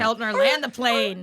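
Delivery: cheering Keltner to wrap it up, loud and high; mock coach shout. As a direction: yell it like a coach on the sideline. Friends egg on cohost.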